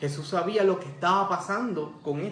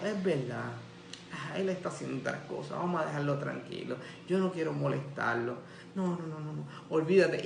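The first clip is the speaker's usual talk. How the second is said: soft, lower, giving-up voice; quiet resignation; 3 semitones lower, 8 dB quieter than usual. Retold or imitated. Imagined blind man gives up calling Jesus, quiet resignation. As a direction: back down meekly, almost to yourself. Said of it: "no simplemente se quedó tranquilo y dijo".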